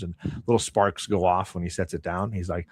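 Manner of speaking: ordinary talk, nothing marked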